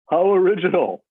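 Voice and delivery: high, loud, mocking how original; patient teen mocks a complaint, sarcastic. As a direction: lay the sarcasm on thick. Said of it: "ignoring his sarcasm".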